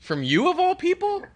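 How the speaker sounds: high-pitched mock indignation; incredulous comeback, voice pushed way up high; Liv. Host plays the wrestler calling out her rival, high and incredulous. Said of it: "if Liv looked at her and said".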